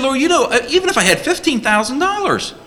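disbelieving disciple. Acted. Disciple telling Jesus the money won't stretch, high incredulous plea. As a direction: plead that it's impossible. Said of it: "He's saying".

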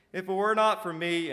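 loud, high, crowing imp; cackling boast. A narrator's reading. Imp claiming credit before bigger demons, crowing. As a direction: crow over the big shots. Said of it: "and he cackled".

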